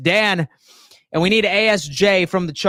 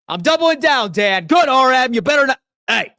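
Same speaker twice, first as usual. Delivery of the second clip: much higher, louder, fired-up doubling down; hyped streamer yell; 7 semitones higher, 5 dB louder than usual. Real, not acted. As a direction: yell it at the chat, all pumped. Hyped, yelling at chat.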